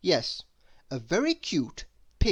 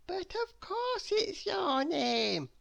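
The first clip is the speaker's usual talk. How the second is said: put-on upset little girl; slower than usual. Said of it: "said little Miss Dotty".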